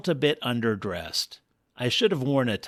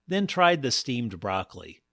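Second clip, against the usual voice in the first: ordinary delivery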